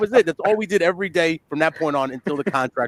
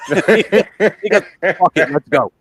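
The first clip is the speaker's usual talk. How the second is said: laughing while speaking, louder; 5 semitones higher, 5 dB louder, much slower than usual. Real, not acted. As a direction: crack up telling it.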